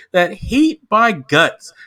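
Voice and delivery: normal talking voice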